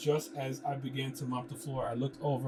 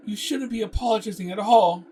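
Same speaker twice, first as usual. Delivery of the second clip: loud interruption; 8 semitones higher, 11 dB louder than usual. Acted. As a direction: cut in loud and high. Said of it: "I interrupted".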